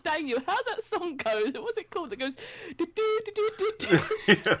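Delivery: imitating mum. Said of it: "she called Daniel and was like".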